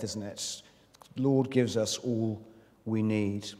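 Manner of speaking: ordinary talk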